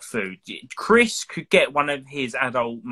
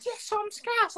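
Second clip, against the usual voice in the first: very high, put-on Scouse reply; mocking a friend's Scouse accent; imitating Jason's Scouse; 5 dB quieter, slower than usual. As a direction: answer like a squeaky Scouser. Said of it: "He went".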